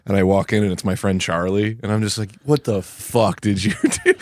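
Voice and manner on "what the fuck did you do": slow disbelief. Retold or imitated. Finding his friend Charlie there.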